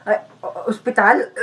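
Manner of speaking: weak, faltering question in a fake sick voice; put-on feeble stammer; feigning sickness. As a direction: catch yourself and sound poorly.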